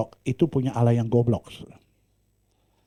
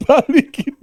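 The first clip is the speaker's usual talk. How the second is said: high laughing line; 8 dB louder than usual. Real, not acted. Preacher laughing at his own fear, laughing high. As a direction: laugh through the word.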